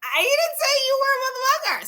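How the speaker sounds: squeaky high pitched mock Karen protest. Karen protesting to Eugene, high mock voice. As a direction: pitch it way up as a flustered man. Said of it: "I'm like".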